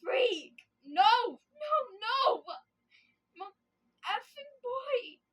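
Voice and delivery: loud, high wailing sobs; screaming and crying. Acted. She wails over Mel's dead body, sobbing loudly. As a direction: wail in anguish over the body. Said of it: "start screaming and crying at the top of my lungs".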